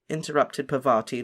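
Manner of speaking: usual speaking voice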